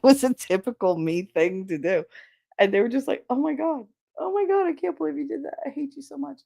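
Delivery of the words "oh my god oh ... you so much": high, fast squealing "oh my god"; mock disbelief. Guys freak out at the kiss, high mock squeal. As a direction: squeal it like stunned friends.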